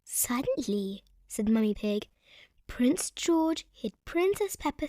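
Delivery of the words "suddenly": whispered, dramatic pause before it; hushed suddenly. A narrator's reading. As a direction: drop to a whisper for suspense.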